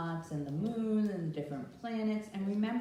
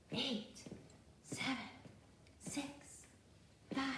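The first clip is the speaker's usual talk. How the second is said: whisper; 3 semitones higher, 13 dB quieter than usual. Shown as a demonstration. Counting softly in the countdown.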